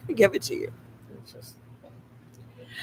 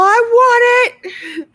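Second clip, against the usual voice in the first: whiny want; 8 dB louder, much slower than usual. Retold or imitated. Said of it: "So, I'm all".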